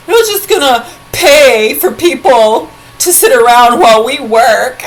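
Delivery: high, loud sneering mimicry of lazy people; mocking quote. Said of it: "then they complain that people".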